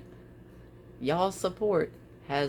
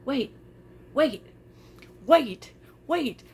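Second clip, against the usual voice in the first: retold surprise; 6 semitones higher, much slower than usual. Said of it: "I was like".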